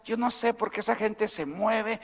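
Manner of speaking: soft, meek mock voice of a shy churchgoer; preacher mocking a meek worshipper who never moves; mocking timid believer. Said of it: "la persona dice".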